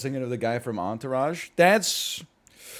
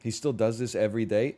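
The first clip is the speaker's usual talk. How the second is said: ordinary talk, nothing marked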